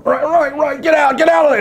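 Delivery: high, frantic shouted reply; Chris Farley shouting. Retold or imitated. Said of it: "Chris, what are you doing?".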